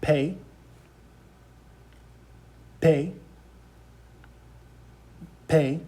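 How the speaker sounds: "pe" as "peh", one short syllable; Spanish letter name. Shown as a demonstration. Teacher having students repeat Spanish P, clear "pe". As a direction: model "pe" clearly by itself. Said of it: "P is pronounced".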